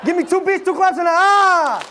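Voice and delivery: high chanted call to the crowd; hype call. Shown as a demonstration. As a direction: lead the chant, pumping them up. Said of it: "one more time".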